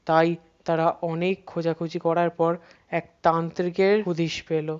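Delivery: ordinary delivery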